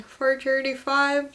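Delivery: ordinary talk, nothing marked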